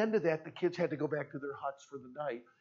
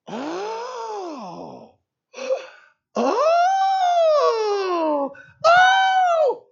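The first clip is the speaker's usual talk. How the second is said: very high, loud gasping oh; huge realization; 12 dB louder, much slower than usual. Retold or imitated. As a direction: gasp as if struck by a revelation. Sudden realization, acted out.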